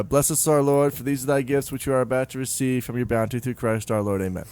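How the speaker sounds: ordinary talk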